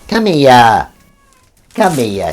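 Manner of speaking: loud, high repeated call; calling a pet. Real, not acted. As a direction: summon a cat from across the room. Calling the cat to come.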